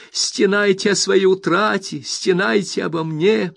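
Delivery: high and loud, slow furious accusation; Rosa, furious. A narrator's reading. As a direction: hammer each word into the mother. Tirade at the mother. (Роза в ярости бросает обвинение матери)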